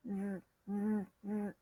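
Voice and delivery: "z" as a soft voiced buzz. Shown as a demonstration. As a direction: hum the end of buzz as a short, quiet "z". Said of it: "We're gonna use buzz".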